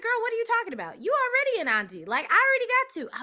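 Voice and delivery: high, loud, fast sassy retort; quick teasing put-down; sassy friend. Retold or imitated. Sister friend teasing her about already being an auntie, sassy. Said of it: "She was like".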